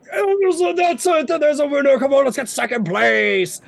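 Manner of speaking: high, loud burst of race excitement; rally them as the winner flies past; excited racer. Acted. Rider Froome as the ranger flies past, excited shouting.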